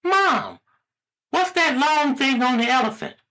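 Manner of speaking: put-on very high-pitched child voice; squeaky small boy asking a question; little boy. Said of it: "the little boy asks his mother".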